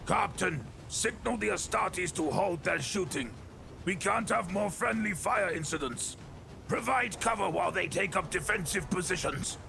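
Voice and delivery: high-pitched, projected military command; commissar giving orders. A narrator's reading. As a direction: call urgent orders down the radio. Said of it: "He picked up his Vox".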